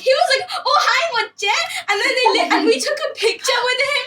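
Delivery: high, laughing retelling; laughing while telling. Real, not acted. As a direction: giggle through the whole story.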